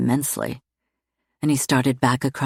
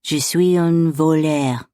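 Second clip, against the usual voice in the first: sweet murmur in French; 4 dB louder, slower than usual. A narrator's reading. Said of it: "Alistair murmured sweetly".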